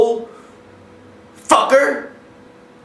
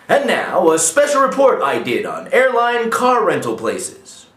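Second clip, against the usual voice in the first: ordinary delivery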